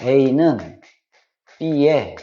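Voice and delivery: slow, low, spaced out word by word; slow model reading. Shown as a demonstration. Teacher to students, stressing the one correct reading, slow and low. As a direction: set each word down on its own. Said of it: "반드시 이렇게 읽어줘야 돼요".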